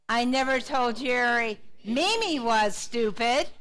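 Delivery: slow sentence, punch Mimi; stress on Mimi. Shown as a demonstration. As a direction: make Mimi stand out from the rest. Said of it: "putting the emphasis on a different word".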